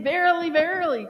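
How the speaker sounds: a loud self-reminder; emphatic verily. Shown as a demonstration. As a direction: wake yourself up with it. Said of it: "say, ... to yourself".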